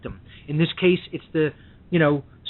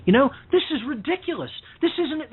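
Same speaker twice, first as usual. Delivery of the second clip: ordinary talk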